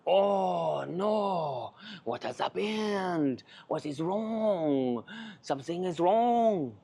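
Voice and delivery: slow, drawn-out mock French lament; imitated French accent, dismayed and very slow; thick French accent. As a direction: stretch every phrase in a hammed-up French accent. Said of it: "beautiful, thick, lovely French accent".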